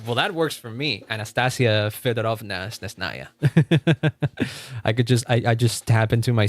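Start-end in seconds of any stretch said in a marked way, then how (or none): none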